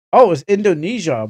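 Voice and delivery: a penny-drop exclamation; sudden realization. Real, not acted. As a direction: catch your own mistake out loud. Realizes the anime's origin.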